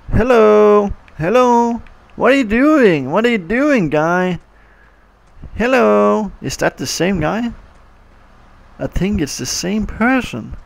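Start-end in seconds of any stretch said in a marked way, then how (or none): 0.1-6.3 higher, louder hailing the drivers; calling out. Acted. Kid calls to the waiting drivers in the game, loud hello. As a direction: call out to the stuck cars.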